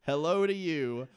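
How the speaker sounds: slow, loud, chirpy fetus; a happy baby calling out from the womb. Pretend. S0 voicing the fetus.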